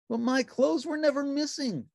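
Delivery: high, bright puppet voice; cheerful little puppet; Ernie voice. A narrator's reading. Narrator voicing Ernie answering the phone, high puppet voice.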